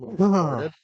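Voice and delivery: cracking voice. Real, not acted. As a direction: voice cracking mid-sentence. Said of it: "That was a bad voice crack".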